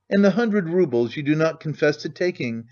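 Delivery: ordinary delivery, nothing marked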